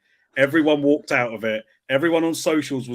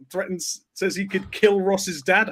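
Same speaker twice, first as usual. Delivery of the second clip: normal talking voice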